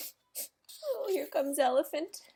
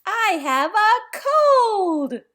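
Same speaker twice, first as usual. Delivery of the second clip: drawn-out announcement; 7 semitones higher, 9 dB louder, much slower than usual. Acted. Gerald announces news to Piggy.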